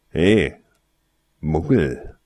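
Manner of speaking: blocking the way with a rude hail; unfriendly. Acted. Boy blocking Tarsoin's way, rude loud greeting. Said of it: "sagte ein Junge unfreundlich".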